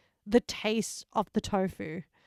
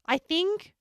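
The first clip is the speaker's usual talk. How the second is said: usual speaking voice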